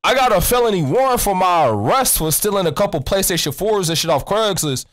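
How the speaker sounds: normal talking voice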